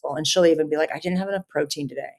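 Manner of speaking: usual speaking voice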